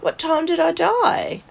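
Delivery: high, lively imitation of Nan; Nan's retold question. Nan to one of the nurses, high-pitched asking.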